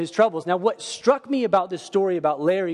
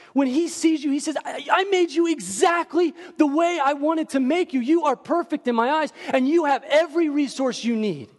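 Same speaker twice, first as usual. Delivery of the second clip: higher, reassuring voice of God; God's tender affirmation; 10 semitones higher than usual. Retold or imitated. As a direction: lift them up as a loving father.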